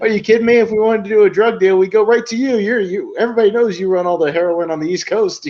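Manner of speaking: high, loud imitation of an amazed crony; mock incredulity. Lackey crediting Angelo with the drug trade, high incredulous gushing. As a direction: gush it out in put-on disbelief. Acts out Hartman's incredulous flattery.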